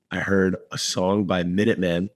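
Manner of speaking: normal talking voice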